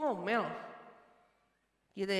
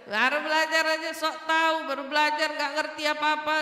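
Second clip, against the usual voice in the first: loud, raised-pitch rant of an acted father; scolding; 4 semitones higher, 5 dB louder than usual. Father to child who just started studying religion, scolding. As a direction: scold the know-it-all child loudly. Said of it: "makin ngomel katanya".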